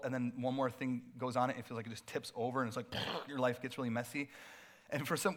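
ordinary delivery

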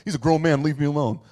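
a fed-up parent waving them off; irritated parent snapping back; exasperated parent. Retold or imitated. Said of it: "like every healthy parent".

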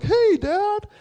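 put-on oblivious kid calling to dad, high and loud; Max. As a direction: call out brightly in a high little-boy voice. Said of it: "he's just like".

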